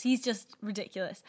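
ordinary talk

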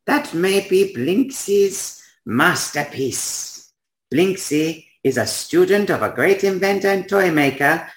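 high, slow, foreign-sounding inventor; eccentric toymaker Blinksy. Acted. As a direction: proudly unveil a masterpiece in a high, drawn-out voice. Voices Blinksy, toymaker figure.

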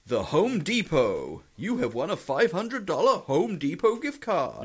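announcer voice reading the spam; hammed-up prize announcer; mock prize announcement.

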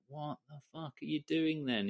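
retold scolding at moderate volume; mock telling-off. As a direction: act out reaming someone out, without shouting. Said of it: "you get reamed out".

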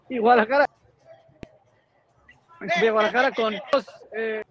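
choppy breaking-up phone line; call dropping out. Real, not acted. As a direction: voice cutting in and out. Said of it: "Ya te estás cortando mucho".

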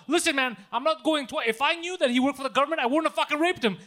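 loud, high insistent protest; mock protest. Prankster to tax scam caller, loud mock protest.

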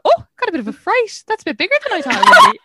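high, loud, hurried yelp of alarm; startled fright. Retold or imitated. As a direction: act out a shocked discovery. Retells her startled reaction in bed.

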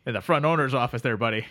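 sleepy Canadian drifter greeting his pal; Canadian accent. Acted. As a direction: play the neighbourly Canadian couch guest. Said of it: "my very cold Canadian heart".

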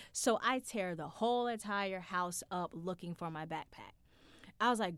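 normal talking voice

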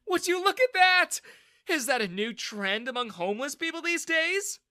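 high, loud mocking sneer; mean rich dad sneering at a soaked young man. A narrator's reading. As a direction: jeer at him, enjoying the prank. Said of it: "Daniel sneered".

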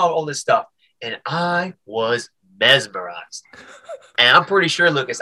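ordinary delivery

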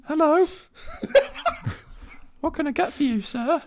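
high, falsetto server greeting; Subway server voice. Acted. Subway worker greeting the mafia boss, high falsetto. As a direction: chirp like an eager sandwich clerk. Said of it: "Subway ordering meal".

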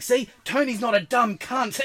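high, loud told-you-so; mock triumphant scolding. Copper turns on the mate once the alibi fails, loud scolding. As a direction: imitate a cop laying into someone.

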